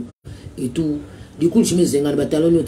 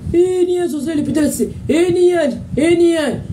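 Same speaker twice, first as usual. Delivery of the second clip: mock outburst; 11 semitones higher than usual. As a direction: yell the "ni un" up high like a heckler.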